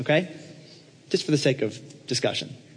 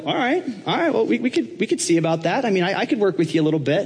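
high, hedging, stammering apostle; Paul stalling the eager applicant, high and unsure; hesitant Paul; 7 semitones higher than usual. Acted. Paul answering Trump, hesitant and hedging. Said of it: "And Paul's like".

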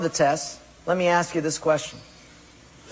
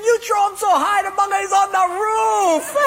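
laughing taunt; 13 semitones higher than usual. Acted. Portuguese guy laughing at the watch on the roof, high shouting mockery. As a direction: crack up while jeering. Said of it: "the Portuguese guy's laughing".

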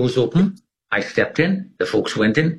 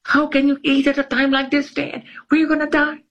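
much higher, far-off panicked woman's voice; panicked supervisor. Retold or imitated. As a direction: squeak it out like a frightened lady. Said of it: "the lady's like".

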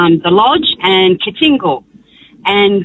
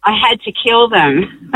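ordinary talk

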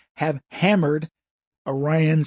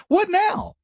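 much higher, gloating squeal; taunting; 12 semitones higher than usual. Acted. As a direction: needle them in a high pitch. Taunting the covid vaxxers.

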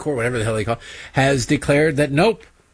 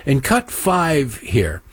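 ordinary talk, nothing marked

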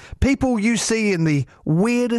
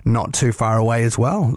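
normal talking voice

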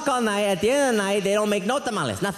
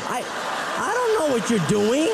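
mock exasperated shout; 8 semitones higher than usual. Mother-in-law bellowing that she can't see him. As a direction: bellow it out in disbelief, stretched. Wife's phone scene, mother speaking.

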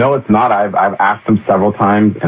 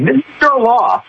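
slow, much higher, pointed; a pitched-up scold; mock scolding; slower than usual. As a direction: drag it out like telling off a child.

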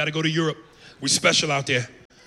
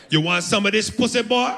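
Jamaican woman; 7 semitones higher than usual. Retold or imitated. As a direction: tease him in a hammed-up Jamaican accent. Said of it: "Jamaican women by nature are real fiery".

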